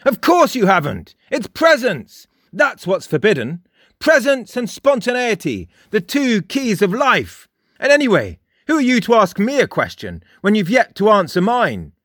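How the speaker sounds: high, loud relentless lecture; merciless barrage. A narrator's reading. Frost drives on at Jake, high and loud. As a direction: keep hammering him without a pause. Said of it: "he drove on mercilessly".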